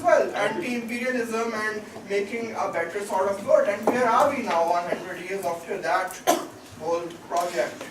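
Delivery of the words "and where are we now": ordinary delivery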